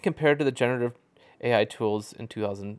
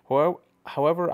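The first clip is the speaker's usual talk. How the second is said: "However, however" punched, higher, louder and fast; emphatic however; 5 semitones higher, 5 dB louder, faster than usual. Real, not acted. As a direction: hit "However, however" hard and quick. Emphatic pivot to own argument.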